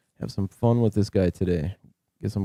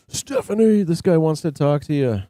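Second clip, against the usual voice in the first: louder and much higher, calling Stephanie to the phone; 6 semitones higher, 4 dB louder than usual. Real, not acted. Streamer calls Stephanie over to take the scammer, loud call. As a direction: call her from across the house.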